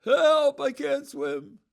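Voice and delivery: high, loud, drawn-out cry for help; drowning man's yell. Acted. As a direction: yell out like someone who has fallen overboard. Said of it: "and he yells".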